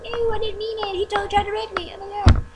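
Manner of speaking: put-on high, whiny ditzy girl voice; mock the schoolgirl's fake innocence, high and airy; mock valley girl. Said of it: "and she's like".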